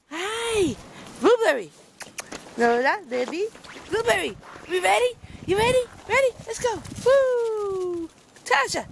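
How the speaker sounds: high, bright calls to the dogs; excited pet talk. Real, not acted. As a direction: hype them up like a game is starting.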